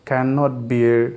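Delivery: extremely slow, drawn out; slowed-down teacher model; slow drill. Shown as a demonstration. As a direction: stretch Cannot bear out word by word. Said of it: "phrase drilled very slowly".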